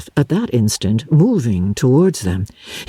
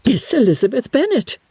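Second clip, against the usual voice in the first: higher, shocked echo of the name Miss Elizabeth Bennet; astonished repeat; 10 semitones higher than usual. Acted. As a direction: throw the name back in disbelief. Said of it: "repeated Miss Bingley".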